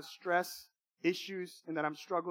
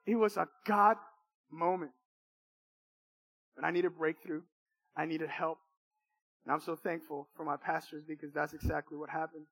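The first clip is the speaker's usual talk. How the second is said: normal talking voice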